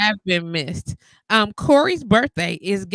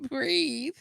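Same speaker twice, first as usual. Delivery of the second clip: breathe, through laughter; telling a friend to calm down, half laughing; laughing at a friend, telling him to breathe; laughing plea; much slower than usual. Retold or imitated. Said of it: "I'd be like".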